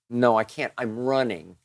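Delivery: ordinary talk, nothing marked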